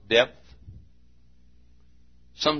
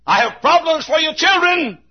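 high-pitched preacher's shout; acted high shout from the world; 5 dB louder than usual. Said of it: "The world shouts".

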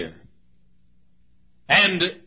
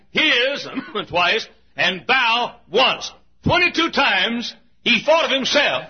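fervent preaching; 7 semitones higher than usual. Acted. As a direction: preach it out with full force. Preaching at full force.

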